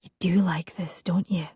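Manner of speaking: soft, intimate whisper. A narrator's reading. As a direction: whisper it close against their ear. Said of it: "whispering against his ear".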